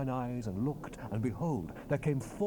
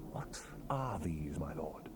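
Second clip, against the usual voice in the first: slow, soft whisper; awed whisper; 7 semitones lower, 7 dB quieter than usual. Acted. The prophet asks the angel about the chariots, reverent whisper. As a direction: murmur it in reverent wonder.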